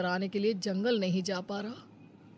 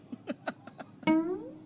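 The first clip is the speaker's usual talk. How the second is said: high gloating cackle; a sneaky, pleased little cackle; sly villain laugh; 10 semitones higher than usual. Acted. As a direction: laugh to yourself over your cunning. Suraj gloats over exploiting friend.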